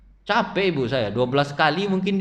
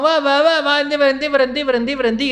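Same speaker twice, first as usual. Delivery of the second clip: put-on louder, higher scolding of a child; scolding mother; 6 semitones higher, 4 dB louder than usual. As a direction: call out to stop him, scolding. (ibu menegur anak mandi hujan, berseru keras)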